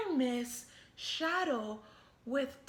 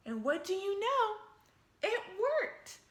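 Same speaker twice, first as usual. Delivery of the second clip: higher, louder and quick with delight; excited reveal; 7 semitones higher, 4 dB louder than usual. A narrator's reading. Narrator cheering Clark's success, excited. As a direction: announce the win brightly. Triumphant story beat.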